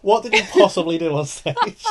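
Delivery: high, loud disbelief; incredulous. Real, not acted. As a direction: demand it in loud shock. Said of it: "disbelief at co-host".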